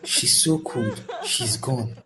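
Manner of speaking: soft words through sobs; breaking grief. Real, not acted. Friend mourning Anita just after she dies, softly sobbing. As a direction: let the voice crack with quiet sobs.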